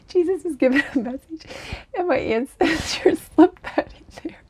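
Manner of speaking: high breathy giggling. Real, not acted. Giggling as her ancestors slip in a message. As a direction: giggle through it.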